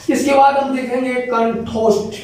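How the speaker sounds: ordinary talk, nothing marked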